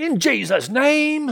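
high, drawn-out preacher's incantation; mock false prophet. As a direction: stretch it out like a showy faith healer. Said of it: "have to say it like that".

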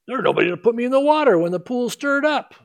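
high-pitched imitation of an arguing man; debating protest. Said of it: "The guy starts debating him".

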